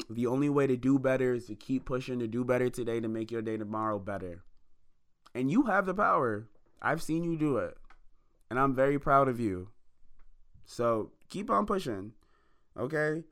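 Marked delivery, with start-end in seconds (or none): none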